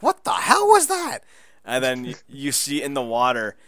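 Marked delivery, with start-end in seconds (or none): 0.0-1.2 high, loud panicked yell; startled shout. Retold or imitated. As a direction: shout in shock at something in the water. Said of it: "Josh is like".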